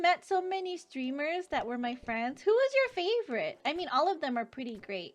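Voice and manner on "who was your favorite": high, loud eager ask; bubbly curious question; excited question. Real, not acted. Asking chat eagerly.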